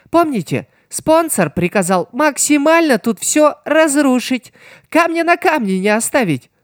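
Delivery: high, playful gloating villain. A narrator's reading. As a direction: play him high and gloating. Voices Piero.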